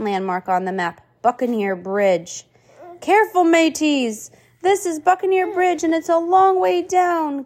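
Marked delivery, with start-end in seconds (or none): 3.0-7.4 nervous. Acted. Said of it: "Gil said nervously".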